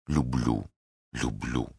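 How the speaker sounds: soft, low murmur of love; very soft, lingering words; hushed confession. A narrator's reading. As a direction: voice a secret thought tenderly. Love read silently in his eyes.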